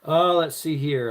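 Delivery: ordinary delivery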